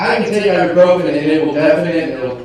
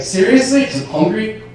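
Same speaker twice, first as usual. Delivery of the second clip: high-pitched, self-mocking disbelief; mock incredulity; 8 semitones higher than usual. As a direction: question your own excuse as if absurd. Mocks his own excuse in disbelief.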